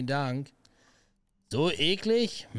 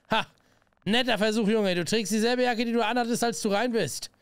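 higher-pitched, unconvinced boss; lightly acted, pitched-up reproach; lightly voiced sceptical boss; 8 semitones higher, 3 dB louder than usual. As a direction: call out the kid's excuse. Voices game dialogue.

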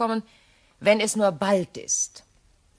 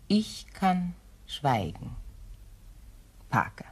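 slow, soft and pointed; widow's quiet menace; quiet threat; 7 dB quieter, slower than usual. Acted. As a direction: let the silence weigh. Veiled threat to Parker.

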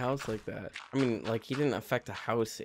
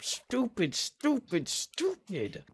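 self-scolding; 11 semitones higher, much slower than usual. Real, not acted. Scolding himself.

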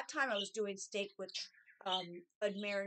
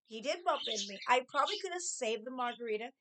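ordinary delivery

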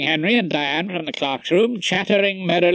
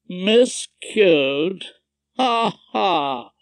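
higher, drawn-out query and hollow ha-ha; mirthless laugh; 4 semitones higher, much slower than usual. A narrator's reading. Judge answering Rumpole's billiards joke, mirthless. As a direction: echo the word, then laugh without mirth. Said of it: "The judge laughed mirthlessly".

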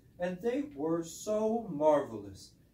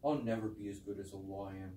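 dejected; 10 semitones lower, 8 dB quieter than usual. Pretend.

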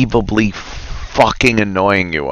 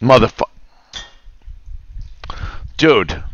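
ordinary delivery

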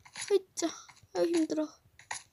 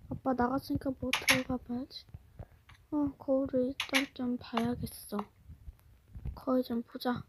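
sneaky murmur; 4 semitones lower, 9 dB quieter than usual. Acted. 몰래 혼잣말.

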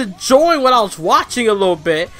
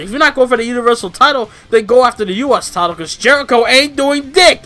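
louder, higher, shouting in frustration; heated rant; 5 semitones higher, 4 dB louder than usual. Real, not acted. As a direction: rant at the bookers, words stretched out in anger. Angry rant about the title.

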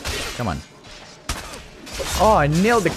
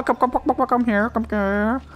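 higher mock chicken-calling voice; 9 semitones higher than usual. Player mimicking game characters who seem to talk to chickens, clucky calling. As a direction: rattle it off like calling hens. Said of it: "they look like they're talking to chickens".

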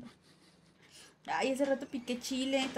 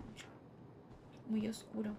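whisper; 4 semitones lower, 12 dB quieter than usual. Real, not acted. Scared of dark game area.

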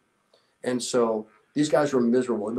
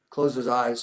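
normal talking voice

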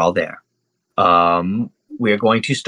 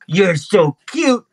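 put-on high, slow baby-talk coo; drawn-out doting creature voice; cooing little monkey; 7 semitones higher, much slower than usual. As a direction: coo over an adorable pet. Monkey coos at the pet.

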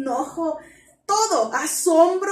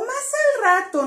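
higher, whiny "later" plea; whiny putting off; 7 semitones higher, 3 dB louder than usual. Retold or imitated. Teacher voicing a kid dodging an order, higher and whiny. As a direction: beg for a little more time. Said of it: "No tenemos la opción de decir".

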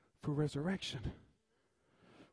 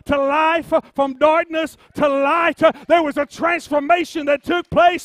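full-force preaching; 6 semitones higher, 14 dB louder than usual. Real, not acted. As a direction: build to high, slow, shouted declarations. Preaches, building to full shout.

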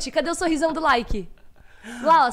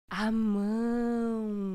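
put-on baby voice; 4 semitones lower, 4 dB quieter, much slower than usual. Voicing baby, slow.

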